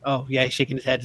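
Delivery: ordinary delivery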